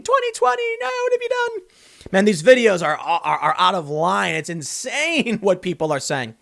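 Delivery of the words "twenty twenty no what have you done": very high wailing mockery; shrill mock lament; mock screaming woman. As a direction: wail like a despairing protester. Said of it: "the video of the women screaming".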